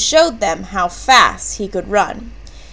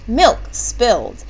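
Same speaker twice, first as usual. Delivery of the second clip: ordinary delivery, nothing marked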